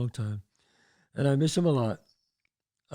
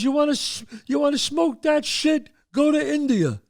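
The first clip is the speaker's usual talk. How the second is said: high, indignant scolding; angry father; 10 semitones higher than usual. Retold or imitated. Father tells off his pot-smoking son, high angry rant. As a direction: rant at the boy, pitched way up.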